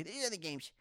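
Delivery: high-pitched mock complaint; mocking Cool Me. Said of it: "That's what he sounds like".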